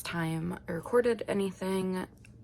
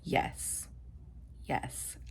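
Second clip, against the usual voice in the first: breathless whispered yes, yes; 6 semitones lower, 10 dB quieter than usual. Retold or imitated. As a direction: hush it out like a tiny private cheer. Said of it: "I'm finally like".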